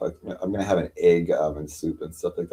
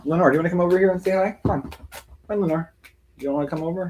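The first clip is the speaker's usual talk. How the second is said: high, quick coaxing sing-song for a cat; 10 semitones higher, faster than usual. Real, not acted. Calling her cat Lenore over, high baby talk.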